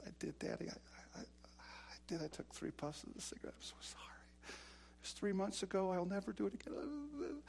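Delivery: high, hushed, whispered pleading; scared child confessing. Retold or imitated. Small son confessing to his father, high and whispered. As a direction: beg like a frightened little boy. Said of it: "child confessing to father".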